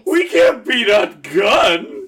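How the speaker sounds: high, loud, slow despairing cry; panicked wail. Acted. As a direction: wail it in disbelief. Acted panic over a gun.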